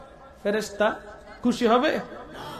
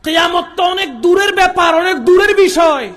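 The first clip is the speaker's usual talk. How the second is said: raised preaching voice, louder and higher; loud proclaiming; 6 semitones higher, 9 dB louder than usual. Real, not acted. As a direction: call it out to the whole hall. Preacher proclaims loudly to congregation. (জোরালো ঘোষণা)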